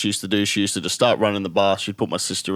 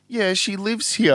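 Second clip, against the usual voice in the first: put-on much higher, slow little girl voice; toddler voice; 10 semitones higher, slower than usual. Little sister tells mum the lady lives here, high and slow. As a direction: innocent small child insisting.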